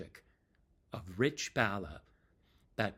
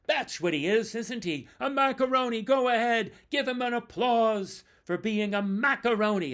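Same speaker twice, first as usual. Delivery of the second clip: high, loud crowd-rousing call; mock rallying; 12 semitones higher, 8 dB louder than usual. Bandmate urging the audience to applaud the joke, high and loud. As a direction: whip the audience up to jeer.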